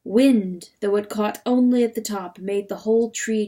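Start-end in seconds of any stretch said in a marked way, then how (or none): none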